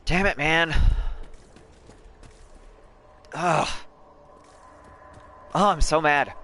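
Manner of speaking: loud, high fake fury; mock anger. Real, not acted. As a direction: rant like the pun hurt you. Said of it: "I promise I'm joking".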